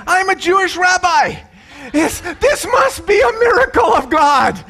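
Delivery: hammed-up amazement at a miracle; excited rabbi. Rabbi's excited speech in the joke.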